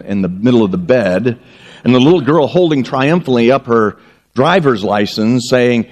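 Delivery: ordinary talk, nothing marked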